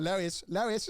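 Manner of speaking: high-pitched cartoon character call; Stewie Griffin. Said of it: "you know Stewie Griffin".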